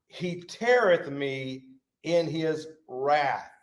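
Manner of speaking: measured, drawn-out King James reading; solemn verse, each word weighed; slow scripture reading. Shown as a demonstration. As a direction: read the old words slowly and solemnly. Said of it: "In other words, he tears me".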